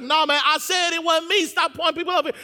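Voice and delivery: high, loud, exasperated protest; agitated denial. Acted. Peter telling the crowd to leave him alone, loudly exasperated. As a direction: push back hard at the accusers. Acted Peter arguing back.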